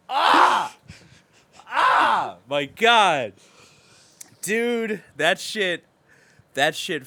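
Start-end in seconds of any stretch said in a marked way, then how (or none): 0.1-3.3 loud high wail of heartbreak; anguished yell. Retold or imitated. Retelling his reaction to the movie, a loud high anguished wail. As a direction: cry out as if the scene just gutted you. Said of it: "And I'm just like".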